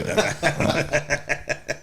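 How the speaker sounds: laughing through the words; giggling as he says it. Real, not acted. Laughing while speaking.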